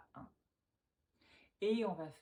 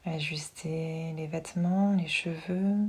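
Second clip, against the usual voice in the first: guided meditation; 14 dB louder than usual. Acted. Yoga teacher settling listeners lying down with eyes closed, hushed meditation voice. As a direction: guide them gently into relaxation, unhurried and close.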